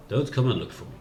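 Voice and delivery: ordinary talk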